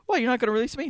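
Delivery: high, lightly hammed-up shock; mock dismay. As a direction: protest in disbelief. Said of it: "he's like".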